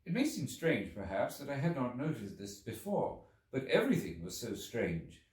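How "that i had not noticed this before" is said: plain reading voice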